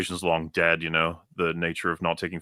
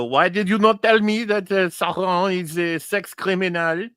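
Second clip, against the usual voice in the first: high, slow mock French accent; 11 semitones higher, 4 dB louder than usual. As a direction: camp it up like a hammy Frenchman. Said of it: "Slash read in fresh, uh, French accent".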